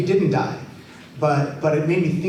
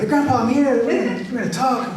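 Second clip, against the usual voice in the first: cheery greeting; 8 semitones higher than usual. Retold or imitated. Knocking at grandpa's door.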